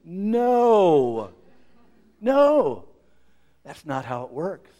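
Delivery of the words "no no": high, loud refusal; emphatic no. Real, not acted. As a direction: shut it down hard. Emphatic rejection, preaching.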